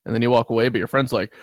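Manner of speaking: normal talking voice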